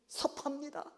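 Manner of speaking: 섭합니다 said softly and sulkily, voiced; a quiet wounded complaint; hurt Peter. Peter telling Jesus he feels hurt, sulking. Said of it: "베드로 입장에서는".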